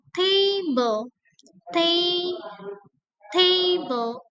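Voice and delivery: TAble with the first syllable pushed high; stress on first syllable. Shown as a demonstration. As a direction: hit TA in "table" hard. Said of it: "pada kata table yang saya buat kapital". (tekanan pada suku kata pertama "table")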